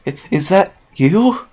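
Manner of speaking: high, slow, stammered squeak; a tiny high squeak of disbelief; barely a squeak. Acted. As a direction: squeak out her name in disbelief. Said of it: "her voice barely a squeak".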